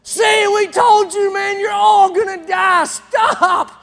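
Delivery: high, loud shouted pleas; frantic shout. Retold or imitated. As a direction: scream at them to come back. Crowd yelling after frog falls.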